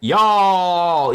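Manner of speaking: a playful, hammed-up Southern American "Y'all!"; mock Southern accent. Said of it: "my skills are legendary where voice acting is concerned, chat, especially accents".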